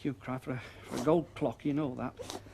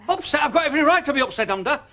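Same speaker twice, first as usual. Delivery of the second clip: indignant, louder and higher; angry outburst; 10 semitones higher, 10 dB louder than usual. Acted. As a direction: shout his hurt pride at her. Husband angry about wrecked car.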